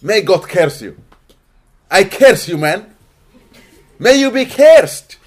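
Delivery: loud, high mock curse; imitated cursing. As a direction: hurl it out like someone cursing, last word drawn out. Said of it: "Have you heard people always".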